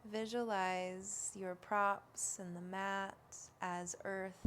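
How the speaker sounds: slow, hushed yoga relaxation voice; unhurried, even meditation delivery, words spaced out; guided meditation. Real, not acted.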